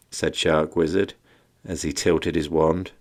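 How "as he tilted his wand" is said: ordinary delivery, nothing marked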